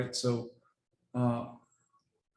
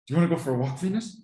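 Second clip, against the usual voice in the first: higher, louder, coaxing dog-walk offer; excited owner asking her dog Venus about a walk; enticing excited call to dog; 4 semitones higher, 3 dB louder, faster than usual. Shown as a demonstration. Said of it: "I'll kinda give you an example".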